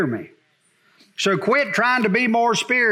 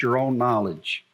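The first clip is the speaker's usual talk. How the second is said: normal talking voice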